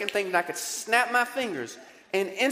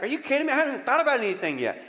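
exasperated self; 8 semitones higher, 4 dB louder, much faster than usual. Retold or imitated. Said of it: "You're like".

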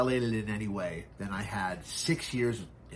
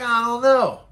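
pitched up and loud, exasperated; high agitated; 11 semitones higher, 10 dB louder than usual. Retold or imitated. Answering the cop about his buddy, high and agitated. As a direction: blurt it out, rattled and worked up. Retold outrage at arrest.

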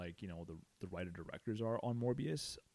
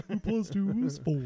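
dumb voice; 11 semitones higher, 10 dB louder than usual. Pretend. Mocking dumb friend, laughing.

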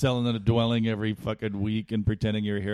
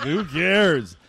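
mock defiant; 7 semitones higher, 6 dB louder than usual. Drunk comic to crowd.

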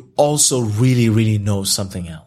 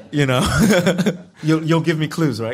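chuckling through the words, playfully accusing; laughing teasingly; 4 semitones higher than usual. Real, not acted. Novelist laughing as he teases the interviewer about repeat questions.

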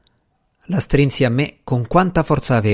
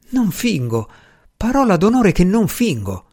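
higher, anguished insistence, a touch of a moan; moaned plea; 6 semitones higher than usual. A narrator's reading. Woman swearing she isn't pretending, moaned plea. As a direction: plead it, voice lifting toward a moan. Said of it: "le vennero fuori in un gemito".

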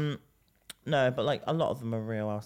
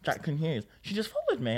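ordinary talk, nothing marked

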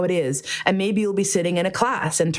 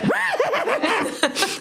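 long high horse neigh; happy whinny. Retold or imitated. Won over after ten minutes, happy pony whinny. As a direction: let out an exuberant mock neigh. Now happily playing the pony.

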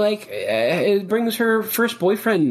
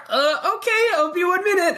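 upset daughter; 13 semitones higher than usual. Acted. Daughter reacting in scene.

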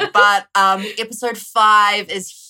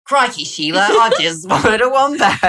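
mock Australian accent; 3 semitones higher, 7 dB louder than usual. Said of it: "accent bit again".

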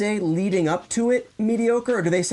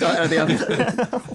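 laughing joke; slower than usual. Real, not acted.

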